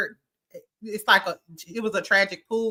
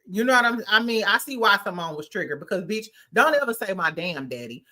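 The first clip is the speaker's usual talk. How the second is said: ordinary talk, nothing marked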